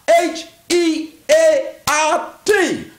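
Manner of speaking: H E A R T said letter by letter, slowly; slow, emphatic spelling of H E A R T; spelled out. Shown as a demonstration. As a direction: spell H E A R T out deliberately. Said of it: "spelling out heart".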